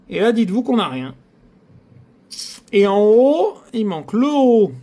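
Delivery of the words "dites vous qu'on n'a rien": ordinary talk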